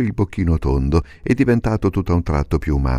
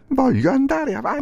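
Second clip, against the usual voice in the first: molto più alto, testardo; burattino ostinato. Acted. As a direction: ribadisci acuto. Pinocchio ostinato. (burattino testardo, voce acuta)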